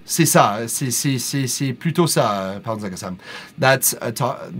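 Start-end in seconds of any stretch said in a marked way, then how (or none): 0.1-2.6 put-on France French accent. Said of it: "That's more French France".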